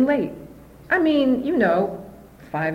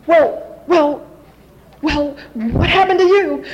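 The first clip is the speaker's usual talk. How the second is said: alarmed mother; 7 semitones higher, 5 dB louder, slower than usual. Retold or imitated. Mother to her crying daughter, high alarmed voice. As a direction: imitate a startled mother, high and loud.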